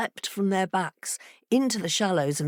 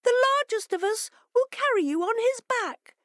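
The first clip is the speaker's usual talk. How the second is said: much higher, eager fish voice; fishes replying. A narrator's reading. Fishes promising the fox a dry ride, high and eager. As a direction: squeak it out reassuringly. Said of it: "replied the fishes".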